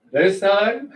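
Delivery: incredulous laugh. Real, not acted. Preacher mocks Pharaoh's excuse, laughing in disbelief. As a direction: scoff at the phrase this time with a chuckle.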